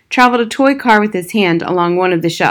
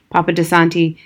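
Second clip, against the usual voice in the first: usual speaking voice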